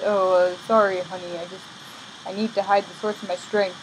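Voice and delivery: low, subdued mock apology; put-on sheepish boyfriend; sheepish Samson. Samson apologises to Delilah, acted.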